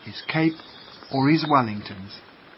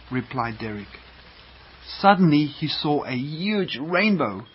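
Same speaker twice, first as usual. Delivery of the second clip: plain reading voice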